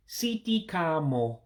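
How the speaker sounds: "sitikamo" slow, "see-tee-kah-mo", each I as E; "sitikamo" drawn out, ee vowels and final o. Shown as a demonstration. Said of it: "I'll say it slow".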